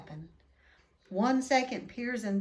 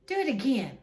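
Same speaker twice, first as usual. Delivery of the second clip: put-on louder, demanding child's command; bossy order; 3 semitones higher, 7 dB louder, faster than usual. A narrator's reading. Boy ordering his father at the zoo, bossy. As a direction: bark it like a spoiled boy. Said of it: "Dudley ordered".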